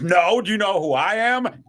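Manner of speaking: high-pitched, emphatic retold retort; imitating a cocky DJ's indignant reply; one-upping comeback. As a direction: turn the challenge right back at him. Acting out friend's comeback, one-upping.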